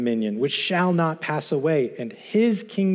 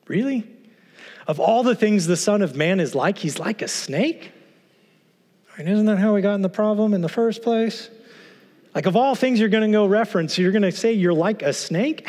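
incredulous disbelief; 6 semitones higher than usual. Pretend. Preacher mocks disbelief at the comparison.

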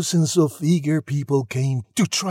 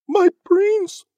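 put-on high, loud girlish voice; Cindy's dismayed thought; 6 dB louder than usual. A narrator's reading. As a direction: exclaim it high, aghast. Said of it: "she thought".

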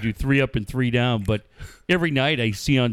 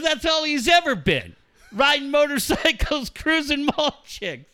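high, drawn-out imitation of mom; mocking mom; 14 semitones higher than usual. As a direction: play mom amused in the background, much higher. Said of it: "I hear his mom on the background just fall over laughing".

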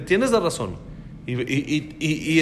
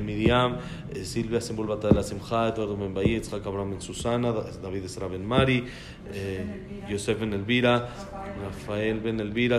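lower, quieter, steady reading of names; dedication list reading; 8 semitones lower, 5 dB quieter than usual. Acted. Teacher reading the dedication names to the class in a low, even voice. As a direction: read the names out as a solemn dedication.

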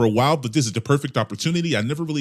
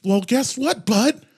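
ordinary talk

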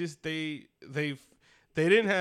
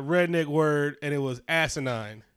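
ordinary talk, nothing marked

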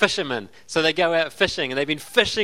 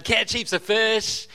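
ordinary talk

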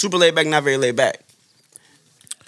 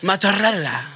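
put-on Italian accent. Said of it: "It's Italian".

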